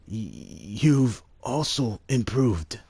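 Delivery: slow, lightly acted elder; a hint of a wise old ruler, slowly; lightly voiced old lord. Streamer reading Lord Godo's praise as a slow elder.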